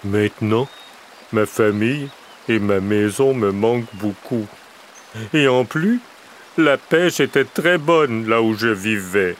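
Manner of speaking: a hint of a small bear missing home; lightly voiced little bear, homesick. A narrator's reading. Little bear telling the crow he misses home, lightly voiced. Petit Ours tells his story.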